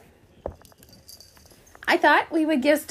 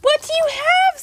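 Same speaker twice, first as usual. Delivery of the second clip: high cooing; 11 semitones higher, 4 dB louder than usual. Real, not acted. Cooing at the cat.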